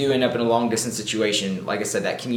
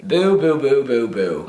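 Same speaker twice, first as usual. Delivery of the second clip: booing; 5 semitones higher, 6 dB louder, slower than usual. Pretend. Booing the lines.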